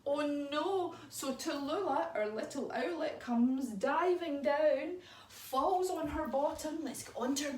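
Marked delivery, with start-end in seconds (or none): none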